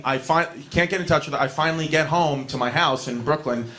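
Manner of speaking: ordinary delivery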